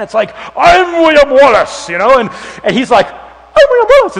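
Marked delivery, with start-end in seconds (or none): 0.6-1.9 loud, slow, booming mock-heroic shout; huge giant hero. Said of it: "this huge giant of a man". 3.1-4.2 very high, loud tiny-man voice; little guy. Retold or imitated. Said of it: "It's like this little guy".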